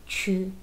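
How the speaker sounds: 曲 held low in third tone. Shown as a demonstration. Teacher isolating the syllable, 曲 in low third tone. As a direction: say 曲 on a low dipping tone. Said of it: "the third tone".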